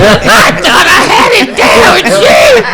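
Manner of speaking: high, loud laughing protest over a fumbled name; laughing exclaim. Real, not acted. Host laughing loudly after botching a panelist's name.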